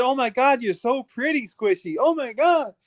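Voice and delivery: high, gushing fake compliment; mock gushing fan. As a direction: gush like a smitten admirer.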